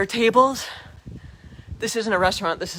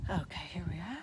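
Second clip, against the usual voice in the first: whispering; 3 semitones lower, 15 dB quieter than usual. Real, not acted. Quiet spot inside the abbey.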